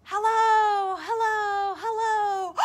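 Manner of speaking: high, fading echoing hellos. Acted. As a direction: let the words ring back like an echo. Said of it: "And he heard".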